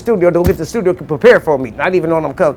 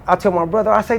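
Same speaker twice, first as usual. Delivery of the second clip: ordinary delivery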